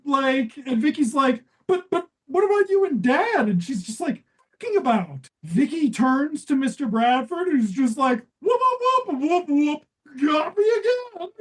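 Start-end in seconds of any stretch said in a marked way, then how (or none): 1.6-3.5 high pleading daughter voice; mock teen plea; whiny teen daughter. Vicky pleading, voiced in recap. 8.4-11.3 high wailing dad voice, hammed up; fake-sob like a caught-out father; hammed-up whimpering dad; mock crying dad.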